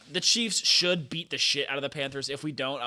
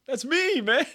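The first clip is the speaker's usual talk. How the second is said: high mock quote; 13 semitones higher, 7 dB louder, slower than usual. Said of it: "I think you would say".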